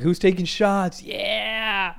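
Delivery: high, drawn-out party call; rally the room for shots, loud and stretched; rowdy instigator. Retold or imitated. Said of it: "I was, like, being an instigator".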